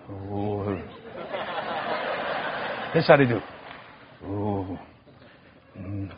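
very soft low nervous moan; faint mock fear; hushed frightened ooh. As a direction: moan softly like a scared passenger. Mimicking frightened passengers.

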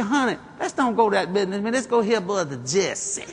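stretched-out Southern wifely coaxing; drawling sweet lady. Retold or imitated. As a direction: coax like a lady, drawing out the end. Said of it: "That's how she talked".

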